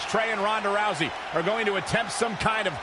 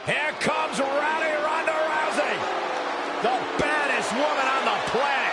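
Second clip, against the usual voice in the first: hype announcer; 6 semitones higher than usual. Acted. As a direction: boom it out like an arena announcer. Hype intro of entering wrestler.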